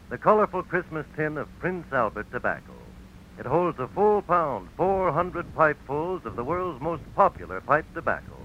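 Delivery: usual speaking voice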